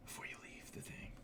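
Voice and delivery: breathy whispered aside; a muttered afterthought, whispered. Real, not acted. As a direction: whisper it to yourself.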